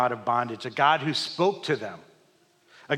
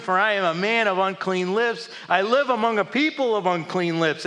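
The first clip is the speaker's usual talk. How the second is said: raised, high lament; anguished cry; 8 semitones higher, 7 dB louder than usual. Acted. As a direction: wail out the unclean lips. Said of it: "I cried".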